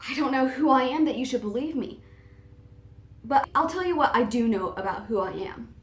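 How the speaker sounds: ordinary delivery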